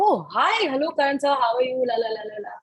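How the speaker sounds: a fan suddenly on the phone with a star, gushing; flustered excitement. Retold or imitated. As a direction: gush at a famous caller, tripping over words. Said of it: "So I said".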